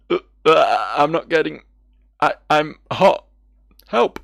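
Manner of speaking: loud, broken cries rising in pitch; halting, hammed-up agony building to a yell; acted distress. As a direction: play someone overheating and begging for help. Character burning up, calls for help.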